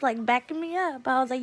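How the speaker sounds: plain reading voice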